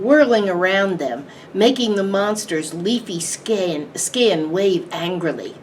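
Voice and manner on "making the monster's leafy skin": ordinary delivery, nothing marked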